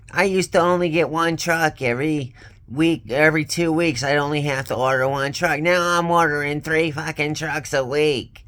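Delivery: high, whiny mock of the boss complaining; mocking boss's scream. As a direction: imitate the boss shrieking about orders. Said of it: "and scream every time I fucking talked".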